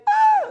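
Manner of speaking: shrill, very high movie-victim scream; horror scream. Pretend. Comic acting out the horror movie victim, piercing scream. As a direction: shriek like the victim in a slasher film.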